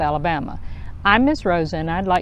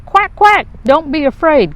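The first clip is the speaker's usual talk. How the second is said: high, loud quacking call; 9 semitones higher, 6 dB louder than usual. Acted. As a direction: call across the water to her duckling. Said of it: "called Mother Duck".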